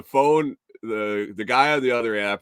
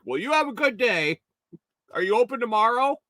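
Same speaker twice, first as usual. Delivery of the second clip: lightly voiced old lady; 12 semitones higher, faster than usual. Old lady closes call.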